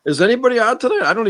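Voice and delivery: high, loud exasperated question. Real, not acted. Heated group argument, high outburst.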